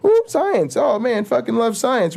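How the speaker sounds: high, gushing fan voice climbing into a squeal; gushing science fan. Retold or imitated. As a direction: swoon over science like a giddy fanboy. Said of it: "And you're like".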